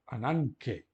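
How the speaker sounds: "ananke" with the gamma sounded as n before k; gama lido como nu. Shown as a demonstration. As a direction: read "ananke" carefully, n in place of g. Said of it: "Vamos ler este gama como nu". ("ananke" com o gama pronunciado como n)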